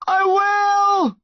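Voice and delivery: high, strained shout; desperate yell. A narrator's reading. Son answering his terrified mum, yelling. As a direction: yell to reassure her through panic. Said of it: "Connor yelled".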